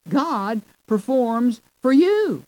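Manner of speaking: "God performs for you" loud and slow, word by word; emphatic stress. Shown as a demonstration. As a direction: punch "God", lift "you" high, then drop. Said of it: "That's the emphasis".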